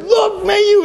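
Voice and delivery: very high imitation of a startled scream; retold scream. Sis points out the giant UFO, retold scream. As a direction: shriek it high, mimicking her alarm. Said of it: "Sis screamed and said".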